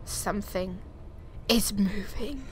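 hushed, scared whisper; frightened whisper. Real, not acted. Alone in the dark house, whispering in fear. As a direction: whisper it so the thing won't hear. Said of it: "unvoiced whisper".

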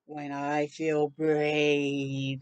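low, slow title announcement; story title read slowly to little listeners. Acted. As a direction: draw out the book title for children.

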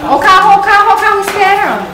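thrilled mom calling; excited shout. Real, not acted. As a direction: shout it out with delight. Mom excitedly shows kids car.

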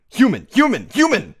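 high, loud repeated command; urgent shouting. A narrator's reading. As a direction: fire off each shout, falling sharply. Urgent answer to panicking friend.

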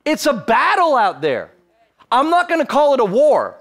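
rising, loud, driving sermon voice; preaching at full force. Acted. Preacher rallying the church against the devil, loud and climbing. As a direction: build the congregation up like a revival.

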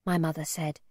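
plain reading voice

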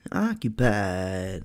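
slow drawled southern imitation; southern accent. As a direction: stretch it out like the swamp man. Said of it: "with that accent".

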